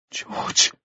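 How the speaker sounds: soft, voiceless whisper of a name. A narrator's reading. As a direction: breathe it out without any voice. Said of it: "she whispered".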